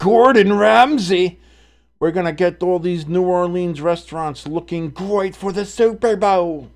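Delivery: hammed-up Gordon Ramsay English accent, badly faked; attempted English accent. Streamer imitates Gordon Ramsay fixing New Orleans restaurants, bad English accent. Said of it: "I can't do an English accent".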